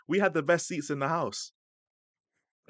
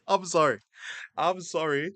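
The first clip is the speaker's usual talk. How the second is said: laughing, higher-pitched apology; real laughing apology; 5 semitones higher than usual. Real, not acted. Streamer apologising to viewers for the misheard word, cracking up. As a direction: apologise through a fit of laughter.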